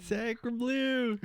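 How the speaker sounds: high, playful fake French; high mock French. Friends trading jokes, a high fake French exclamation. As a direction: toss it off in a put-on French voice. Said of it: "echoing the French joke".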